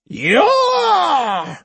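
loud roaring bellow; fierce roar. A narrator's reading. Hyogo roaring to scare the boy, fierce bellow. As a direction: roar from the pit of the stomach. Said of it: "a fierce roar".

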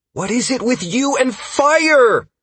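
high, loud exasperated yell; yelling back. A narrator's reading. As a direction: shout it back in disbelief. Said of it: "Caius yelled back".

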